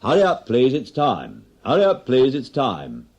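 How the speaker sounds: barman's closing-time shout; pub closing call. Acted. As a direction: call last orders over the noise.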